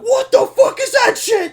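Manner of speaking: furious high yell, dragged out; angry shout. Retold or imitated. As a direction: scream it at the top of your voice. Angry outburst at mom, retold.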